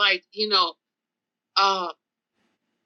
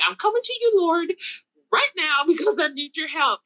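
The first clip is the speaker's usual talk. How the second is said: raised-pitch appeal to God; pleading prayer; 8 semitones higher than usual. Retold or imitated. Driver begging the Lord for help, pleading. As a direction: call out urgently for divine help.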